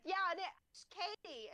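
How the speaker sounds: put-on high, drawn-out accented name; put-on Leon accent. Mocking a game hero naming his partner, hammed-up accent. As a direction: stretch the name in a cartoonish American accent. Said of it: "Katie just sounded like it worked with the accent".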